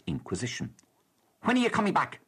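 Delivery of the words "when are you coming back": high, louder mock snap of a cross clerk; snapped question. Ticket clerk snapping at a traveller, mock ill-tempered. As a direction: snap it like a grumpy official. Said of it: "snapped a rather ill-tempered official".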